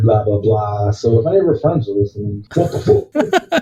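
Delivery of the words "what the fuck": high, slow, drawn-out what the fuck; exaggerated outraged squeal; mock outrage. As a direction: stretch it out in disbelief. Calling out ex's friends.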